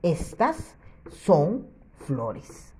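slow, clearly spaced reading. Shown as a demonstration. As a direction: read it out slowly for the class. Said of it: "Ahora sí dice".

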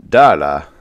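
a short burst of surprise; exclaiming. A narrator's reading. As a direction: call the name out in sudden surprise. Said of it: "Kuru exclaimed".

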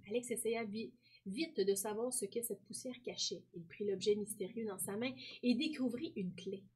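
soft storytelling read-aloud; story narration. Acted. Teacher narrating Océane's story for pupils, hushed. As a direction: tell it like a bedtime mystery.

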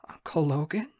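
small, whispery question; meek. Acted. Raz calls to collapsed uncle, meek. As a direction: ask timidly, rising at the end. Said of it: "I ask meekly".

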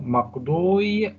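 مقضوي drawn out slowly, stumbling over the waw; hard to say. Shown as a demonstration. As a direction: show how clumsy مقضوي sounds, very slowly. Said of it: "صعبة في النطق". (نطق ثقيل ومتعثر لكلمة مقضوي)

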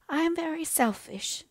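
high, thin voice of a sick mother; an invalid mother gently apologising to her children, high and frail; frail dying mother. A narrator's reading. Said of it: "said she".

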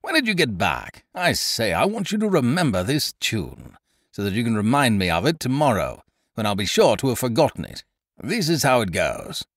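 a jolly bluff chap chattering on; hearty Ukridge. A narrator's reading. Ukridge telling his friend to remember a tune, hearty.

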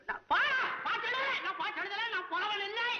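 high-pitched frantic wail; hammed-up hysterical disavowal; panicked denial. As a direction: protest wildly that you are innocent. Poet in despair disowns his verse.

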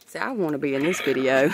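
normal talking voice

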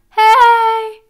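loud, high cracking call. Shown as a demonstration. Coach demonstrating a vocal crack, loud cracking hey. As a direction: belt it high until it breaks. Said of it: "when we experience a vocal crack".

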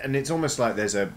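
usual speaking voice

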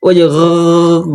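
গ- held slowly like gargling. Shown as a demonstration. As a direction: draw out গ- as a gargle. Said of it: "গড়গড়া করছি". (গড়গড়ার আওয়াজে টানা গ)

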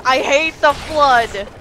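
loud exasperated outburst; frustrated yell. Real, not acted. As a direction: shout it out in frustration. Rant builds to a yell.

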